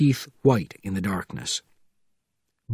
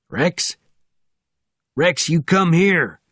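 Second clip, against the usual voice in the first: raised, drawn-out call, higher and louder; calling the dog; 6 semitones higher, 5 dB louder, slower than usual. A narrator's reading. Driver calling his dog Rex over, loud drawn-out call. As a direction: call out sharply to bring a dog back.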